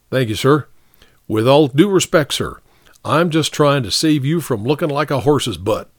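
lightly voiced sergeant, respectful but insistent; frank sergeant. Sergeant telling the captain the charge won't stick, mildly insistent. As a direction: argue firmly with a superior.

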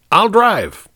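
high and loud, repeating the order; insistent command. A narrator's reading.

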